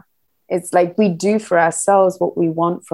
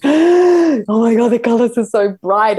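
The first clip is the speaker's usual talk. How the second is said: awed gasp; 4 semitones higher, 4 dB louder, slower than usual. Retold or imitated. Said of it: "stand in front of them going".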